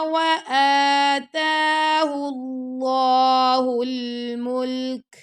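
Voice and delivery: slow, melodic tajweed chant; teacher chanting a verse for students; Quran recitation. Shown as a demonstration. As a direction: recite it slowly with full tajweed.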